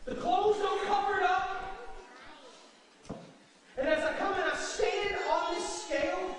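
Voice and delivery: rising, loud, impassioned preaching; preaching at full force. Acted. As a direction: drive it home from the pulpit. Preaches the woman's words loudly.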